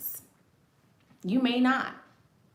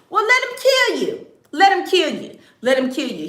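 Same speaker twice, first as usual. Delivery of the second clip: 1 defiant dare; 6 semitones higher, 5 dB louder than usual. Real, not acted.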